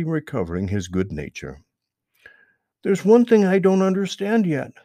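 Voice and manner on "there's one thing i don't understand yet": high, loud, eager rabbit question; curious Peter Rabbit. Acted. Peter asks Mister Toad, high and eager. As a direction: pitch up high and push it out eagerly. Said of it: "said Peter".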